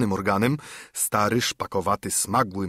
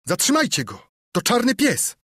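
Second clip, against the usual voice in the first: urgent shout; 12 semitones higher, 4 dB louder than usual. A narrator's reading. Boy alerts inn to fleeing pirate.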